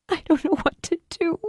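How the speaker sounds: high, helpless and lost; despairing. Acted. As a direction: at her wits' end. Julie before weeping.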